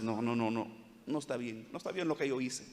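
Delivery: remorseful. Retold or imitated. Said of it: "el cristiano dice".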